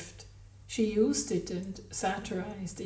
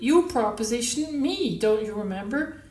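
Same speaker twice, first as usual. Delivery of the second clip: absolute conviction; 4 semitones higher, 8 dB louder than usual. A narrator's reading. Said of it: "She said it with absolute conviction".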